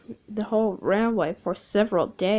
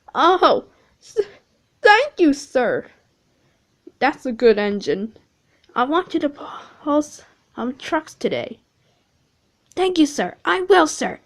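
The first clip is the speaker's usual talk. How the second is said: lightly voiced eager engine, higher; the red engine thanking his boss, a little higher; grateful James; 5 semitones higher, 6 dB louder than usual. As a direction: thank him with relief.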